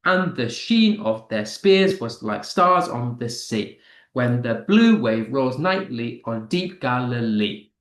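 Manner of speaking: "And the sheen of their spears" in weak-weak-strong beats; anapaestic verse rhythm. Shown as a demonstration. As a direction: make the meter audible, leaning on "sheen", "spears", "stars", "sea". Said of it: "you can kind of hear it".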